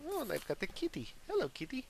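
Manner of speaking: high pet-talk voice; cooing to cat. Real, not acted. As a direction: greet your kitty in a little high voice.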